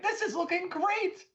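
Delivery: high-pitched, nervous cheerleading; worried encouragement. Acted. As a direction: pitch it way up, covering a little worry. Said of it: "a little bit worried, but I'm like".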